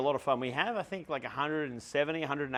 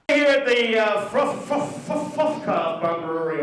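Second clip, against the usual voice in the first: crowd announcement; 6 semitones higher, 7 dB louder than usual. Real, not acted.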